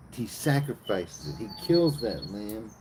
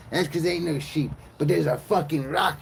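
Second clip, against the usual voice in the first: raised, higher-pitched acted aggression; mock angry; 6 semitones higher, 4 dB louder than usual. Cain turning on Abel with a rock, mock angry. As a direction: build into a louder mock attack.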